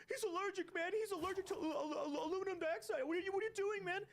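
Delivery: high, hammed-up panicked friend, laughing. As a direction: fake outrage from a frantic buddy, stammering. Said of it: "and then your friend goes".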